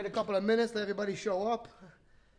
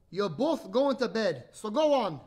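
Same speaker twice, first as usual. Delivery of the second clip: slow, louder fatherly order; firm dad character; 5 dB louder, slower than usual. Acted. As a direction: settle the squabble with a drawn-out command. Story dad settles the argument.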